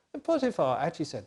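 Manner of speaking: ordinary delivery, nothing marked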